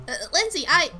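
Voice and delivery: ordinary delivery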